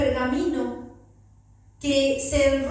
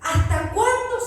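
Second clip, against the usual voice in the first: high, loud crying out; 8 semitones higher, 5 dB louder than usual. A narrator's reading. Souls under the altar cry to the Lord, loud and high. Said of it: "clamaban a gran voz diciendo".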